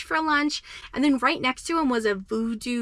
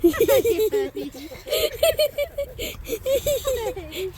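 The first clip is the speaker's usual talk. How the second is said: talking to a dog; 10 semitones higher, much slower than usual. Real, not acted. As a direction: coo at the puppy. Greeting her dog after trip.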